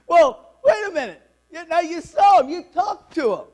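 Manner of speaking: loud, high burst of astonished questions; excited disbelief. Acted. Jacob questioning his sons about Joseph, loud astonishment. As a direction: Jacob can't believe his ears.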